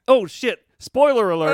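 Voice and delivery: sudden loud high outburst; surprised. Real, not acted. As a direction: caught yourself spoiling it. Blurted a spoiler by accident.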